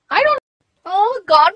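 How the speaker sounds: normal talking voice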